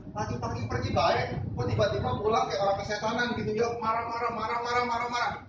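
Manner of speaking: imitating a baffled wife; puzzled complaint. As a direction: grumble in disbelief.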